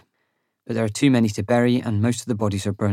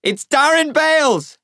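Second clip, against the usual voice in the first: high, loud, drawn-out announcement; slow full-voiced yell; searching shout; 7 dB louder, slower than usual. A narrator's reading. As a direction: shout your name out to any survivors. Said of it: "I shout".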